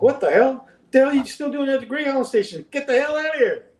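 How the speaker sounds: high-pitched exasperated outburst; retold alarmed disbelief. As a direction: reenact urging a friend to clear out, voice way up. Retold outburst at fugitive friend.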